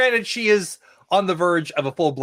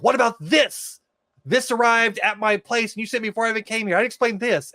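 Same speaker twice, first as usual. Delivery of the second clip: high-pitched indignant Felicity, lightly acted; voicing Felicity; 8 semitones higher than usual. Felicity defending herself to the doctor, raised higher voice. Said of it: "It's like".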